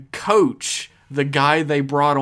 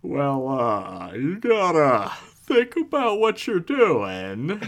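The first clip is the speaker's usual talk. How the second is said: slow, drawn-out, deliberate character voice; Patrick Warburton; 8 semitones higher, slower than usual. Shown as a demonstration. Podcaster showing off a celebrity impression on request, slow and drawn out. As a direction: stretch each phrase out, swooping and deliberate. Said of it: "I can do Patrick Warburton".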